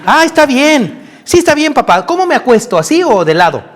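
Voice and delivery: put-on high, singsong cheerful child; mock compliant boy. Preacher mocks idea of a willing son, high child voice. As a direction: parody an all-too-willing little son. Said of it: "No creas que fue".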